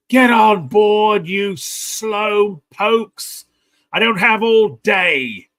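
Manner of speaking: slow, high, loud bellow at the slowpokes; officer's impatient shout. Acted. Officer shouting at the dawdling passengers to board, loud and drawn out. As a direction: yell down the gangplank to hurry everyone. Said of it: "the officer just like".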